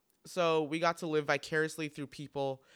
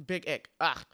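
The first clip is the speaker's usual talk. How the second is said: normal talking voice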